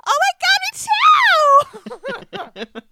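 very high, loud squeal of delight; excited shriek. Retold or imitated. Squealing to the new girl who is suicidal too. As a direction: shriek it like finding a kindred spirit.

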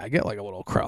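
ordinary talk

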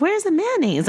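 put-on high-pitched puzzled kid asking; squeaky little kid question; high child voice. Child self asking at McDonald's.

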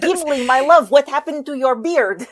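put-on pseudo-Austrian elf accent, high and loud; hammed-up endearment in a weird pseudo-Austrian accent; Legolas. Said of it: "that was Legolas".

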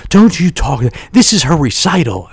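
sharp high scold; imitated ex-wife snapping at him in the parking lot; ex-wife raising voice. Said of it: "then she raised her voice at me".

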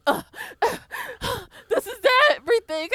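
high, gasping, out-of-breath whimper; mock gasping. Five gasping over Layla, high mock gasping. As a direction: gasp the words out like dying. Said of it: "Five is there gasping".

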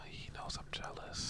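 slow, very soft whispered aside; stage whisper. Pretend. Co-host confessing jealousy as an aside, stage whisper.

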